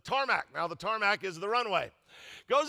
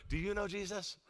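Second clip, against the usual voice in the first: much softer, close confiding question; leaning in softly; 9 dB quieter than usual. Retold or imitated. Flight attendant leaning in to the pastor, soft question. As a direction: lean in and ask it gently. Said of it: "she leans in".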